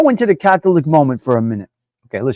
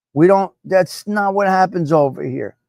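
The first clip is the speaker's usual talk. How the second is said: ordinary talk, nothing marked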